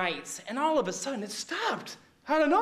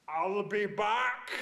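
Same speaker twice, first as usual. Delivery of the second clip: low, slow deadpan line; movie catchphrase; 4 semitones lower, 4 dB quieter, slower than usual. Retold or imitated. Jesus leaving, low deadpan catchphrase. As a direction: say it low and slow like an action hero. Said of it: "he says".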